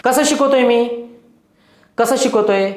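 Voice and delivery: usual speaking voice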